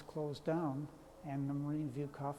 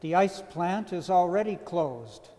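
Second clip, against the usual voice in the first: poetry reading; 6 dB louder than usual. Acted. Poet reciting to a listener about the closed ice plant, measured poetry reading. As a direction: read it line by line, each phrase falling into silence. Reads own poem aloud.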